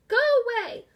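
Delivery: put-on loud, high old woman's yell; cranky old woman yelling. A narrator's reading. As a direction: shout them off the porch. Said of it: "Missus Dodds yelled".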